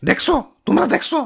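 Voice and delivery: usual speaking voice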